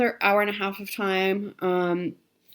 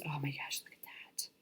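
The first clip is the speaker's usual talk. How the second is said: hushed, breathy admiring whisper; soft delighted whisper over the fabric; 5 semitones lower, 18 dB quieter, faster than usual. Real, not acted. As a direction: whisper it as if it's precious.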